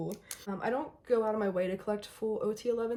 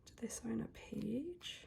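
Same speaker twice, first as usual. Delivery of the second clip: hushed whisper; 2 semitones higher, 9 dB quieter, slower than usual. Real, not acted. Searching the album quietly.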